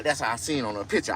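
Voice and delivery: usual speaking voice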